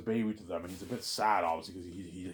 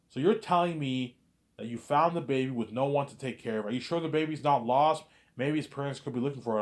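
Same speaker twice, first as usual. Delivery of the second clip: raised, louder, mildly disbelieving recap; incredulous questioning; 5 semitones higher, 4 dB louder than usual. Retold or imitated. Clerk to the man who found a baby, incredulous questioning. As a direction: question the story with mock disbelief.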